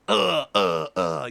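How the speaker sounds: loud, choppy grunts; imitated hit grunts. Game master voices the pinned player, grunting. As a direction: grunt with each blow. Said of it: "And you're just like".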